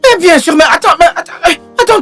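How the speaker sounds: high, eager pleading protest; excited protest. Acted. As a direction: rush in to defend yourself. Maid protests, excited defence.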